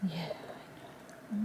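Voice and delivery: slow, hushed whisper to a scared animal; soft whisper. Real, not acted. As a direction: breathe it softly to calm her. Soothing an animal in distress.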